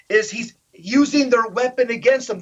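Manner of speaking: ordinary delivery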